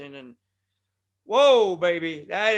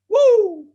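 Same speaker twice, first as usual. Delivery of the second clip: very high, loud whoop; Ric Flair woo; 14 semitones higher, 12 dB louder than usual. Retold or imitated. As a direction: throw in the wrestler's woo. Ric Flair catchphrase again.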